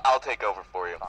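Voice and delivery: usual speaking voice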